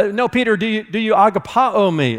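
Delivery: high, firm correction; correcting Jesus. Acted. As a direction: gently push back on his answer.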